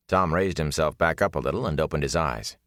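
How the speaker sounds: usual speaking voice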